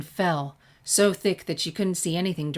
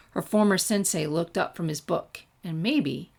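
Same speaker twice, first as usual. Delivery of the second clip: usual speaking voice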